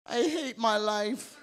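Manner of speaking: hammed-up self-pitying moan; preacher mimicking a self-pitying believer, slow mock whine. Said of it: "He wants you to be like".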